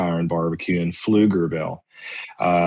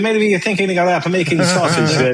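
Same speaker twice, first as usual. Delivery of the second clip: higher, hammed-up Italian accent; 9 semitones higher than usual. Italian friend urging Bill to make a video, hammed-up Italian accent. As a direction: keep the Italian friend's accent going, pitched up. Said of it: "Pretty good accent".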